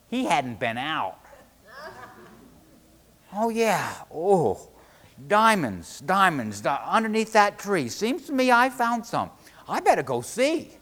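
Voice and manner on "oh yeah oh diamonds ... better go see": loud, rising excitement; excited realisation. A narrator's reading. Fisherman recalling diamonds under that tree, excited. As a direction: exclaim as it dawns on you, pitch climbing. Charlie remembers finding diamonds.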